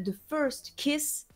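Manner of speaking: self-mocking English accent on a title; title read in a put-on English accent; mock English pronunciation. Shown as a demonstration. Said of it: "avec mon bel accent anglais".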